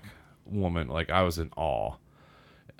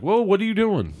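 alarmed question; 12 semitones higher, 4 dB louder than usual. Retold or imitated. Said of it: "She's like".

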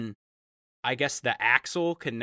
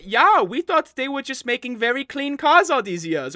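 high neighbor voice; 8 semitones higher, 5 dB louder than usual. Acted. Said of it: "they say".

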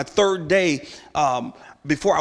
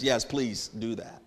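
soft slow yes; 3 semitones lower, 8 dB quieter, slower than usual. Retold or imitated. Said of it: "repeated softly".

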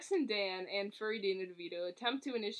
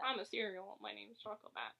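a lightly put-on, much softer cartoon bat; Choco Bat voice; 7 dB quieter than usual. Voicing the bat to co-host, lightly put-on. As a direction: mumble it as a small cereal mascot. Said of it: "that's not Danny DeVito's voice".

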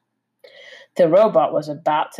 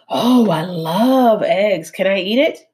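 greedy fox voice; 4 semitones higher, 4 dB louder than usual. Acted. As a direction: savor the thought of eating it. Hungry fox eyes egg.

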